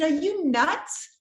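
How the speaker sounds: high, loud, slow disbelief; stunned, can't believe the suggestion; incredulous. Retold or imitated. Woman reacting to advice to visit husband, incredulous. Incredulous reply to pastor's wife.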